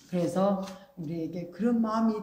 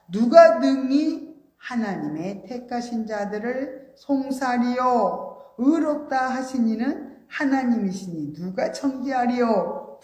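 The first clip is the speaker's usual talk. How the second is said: slow, solemn, louder verse reading; scripture reading; 5 semitones higher, 6 dB louder, slower than usual. Acted. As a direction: draw out each word of scripture. Reads scripture aloud.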